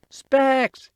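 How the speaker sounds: high, loud call across the yard; yelling. A narrator's reading. Boy calling his friend from the kitchen window, yelling. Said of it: "he yelled from the kitchen window".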